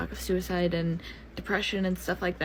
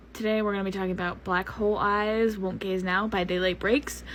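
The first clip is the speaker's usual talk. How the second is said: normal talking voice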